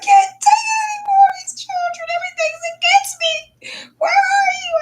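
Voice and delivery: very high, crying shout; retold sobbing prayer, way up high; crying out to God. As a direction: wail to Father in despair. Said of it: "I said".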